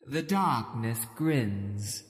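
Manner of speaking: slow, hushed witch intoning a prophecy. Acted. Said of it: "she continues".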